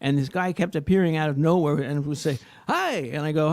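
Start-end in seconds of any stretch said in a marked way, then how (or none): 2.7-3.1 much higher sing-song hi; mock chirpy greeter. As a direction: pop up like an eager helper. The guy popping up in the story.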